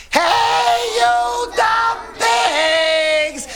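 huge high-pitched, drawn-out holler; full yell. A narrator's reading. Girl yells at pigs from the opened door, full holler. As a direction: yell it at the top of your voice, stretching each word. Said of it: "opened it all the way and yelled".

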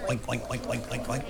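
quick, hushed oinking; soft pig oinks. A narrator's reading. Girl hears pigs at the school door, soft oinking. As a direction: oink softly, like pigs behind a door.

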